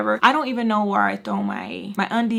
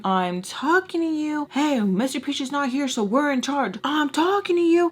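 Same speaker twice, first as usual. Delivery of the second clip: put-on bossy boy; 5 semitones higher than usual. Boy bossing her around.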